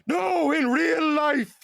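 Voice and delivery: high, slow Irish threat; Irish Sam Hyde impression. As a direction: menace him in a cocky Irish voice. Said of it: "your Irish Sam Hyde impression is very good".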